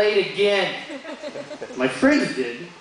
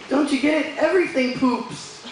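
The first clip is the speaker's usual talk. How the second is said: put-on tag-along kid logic; 7 semitones higher than usual. Voices himself answering his son.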